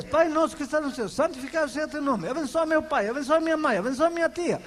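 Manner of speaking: fast mechanical recitation of Pai nosso; rattled-off rote prayer. Shown as a demonstration. Pastor mocking rote prayer, rattled off fast. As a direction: gabble it like a daily chore. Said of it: "isso não é orar".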